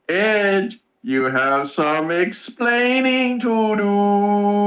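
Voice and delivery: high, slow drawn-out call; mock scolding. Quoting Cena's line to a wrestler, drawn-out mock scolding. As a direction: stretch it into a teasing sing-out of reproach.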